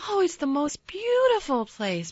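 high breathy protest. A narrator's reading. Mary protesting to Colin about the moor, eager. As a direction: insist it's beautiful, lifted and eager. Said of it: "protested Mary".

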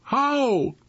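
high-pitched, small "How?"; bewildered question. Acted. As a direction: ask it high, at ordinary volume. Dazed friend asks in story.

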